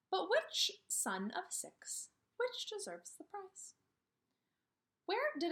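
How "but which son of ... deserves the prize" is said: wondering Anansi. Acted.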